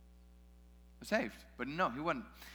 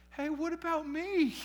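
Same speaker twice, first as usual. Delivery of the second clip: left-out complaint; 12 semitones higher, 4 dB louder than usual. Retold or imitated. Mimics Pawson left out.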